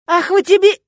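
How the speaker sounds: high, loud attacking yell; furious high shout cut short; battle cry. Acted. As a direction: yell it as you lunge. Lunges at door to attack.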